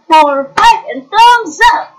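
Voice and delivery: loud cheerful count-off; counting aloud. Real, not acted. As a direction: call out the numbers to the class. Teacher counting aloud with class.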